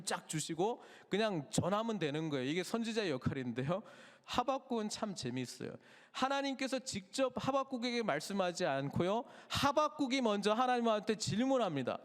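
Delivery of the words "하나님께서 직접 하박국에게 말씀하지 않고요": ordinary talk, nothing marked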